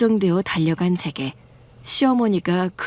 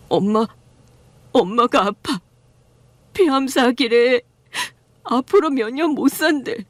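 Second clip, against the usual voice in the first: tearful son breaking down over bad news; a son sobbing out his mother's diagnosis; husband crying; 5 semitones higher than usual. Husband tells mother has cancer.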